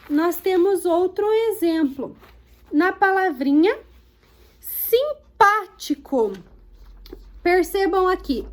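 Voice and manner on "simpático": "simpático" drawn out slowly, PÁ strongest; stress on PÁ. Shown as a demonstration. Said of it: "O pá é a sílaba tônica mais forte". ("simpático" devagar, com força no PÁ)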